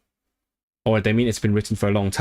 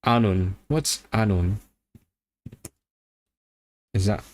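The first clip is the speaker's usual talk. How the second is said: ordinary talk, nothing marked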